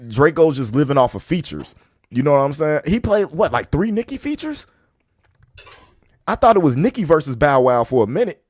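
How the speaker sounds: usual speaking voice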